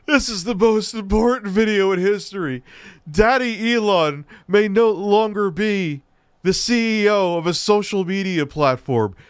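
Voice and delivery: high, hammed-up proclamation; mock dramatic announcement. Streamer mimicking a YouTuber's alarm over Elon, mock dramatic. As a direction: imitate an overwrought video title reveal.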